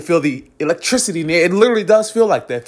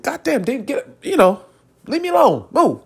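higher-pitched, mock-exasperated dismissal; lightly acted irritation, pitched up; annoyed brush-off; 7 semitones higher than usual. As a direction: shoo away a pest, half amused. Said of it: "for a righteous person, it's more like".

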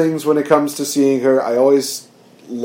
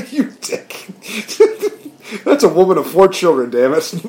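scolding through high-pitched laughter; real laughter; 6 semitones higher than usual. Real, not acted. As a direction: crack up while telling off a friend. Laughing at co-host's dry joke.

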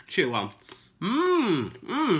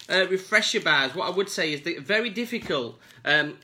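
normal talking voice

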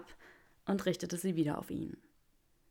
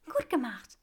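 higher, pleased praise; lightly delighted Granger; 6 semitones higher than usual. A narrator's reading. Granger praising Draco's repair, lightly delighted. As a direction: praise him, still glowing.

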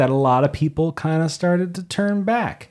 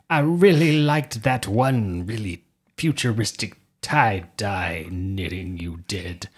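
ordinary talk, nothing marked

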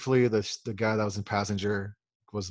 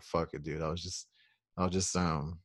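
lower, softer, worn-out; lower, softer resignation about skipping the shower; tired giving up; 4 semitones lower, 7 dB quieter than usual. Retold or imitated. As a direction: say it like you're too tired to care.

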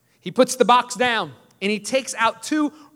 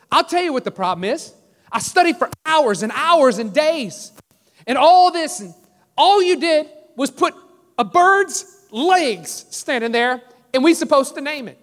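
higher-pitched, emphatic retold complaint; mad student; 6 semitones higher, 3 dB louder than usual. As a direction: play an indignant student arguing back, hitting the key words. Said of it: "He gets so mad".

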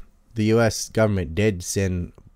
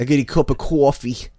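raised, mocking Brooklyn "cawfee"; Brooklyn accent; 5 semitones higher, 4 dB louder than usual. Shown as a demonstration. Mocking northerners ordering coffee, exaggerated Brooklyn accent. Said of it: "they can't say "ah".

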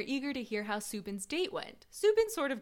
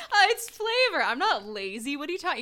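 high, loud sassy defensive voice; mock defensive; 4 semitones higher, 6 dB louder than usual. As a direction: play it cocky and defensive. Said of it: "You're like".

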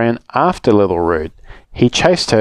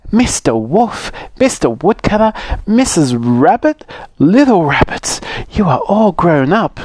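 cheerful Grandma; 7 semitones higher than usual. Acted. Grandma arriving at her party, delighted and high. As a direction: greet them all with a beaming smile.

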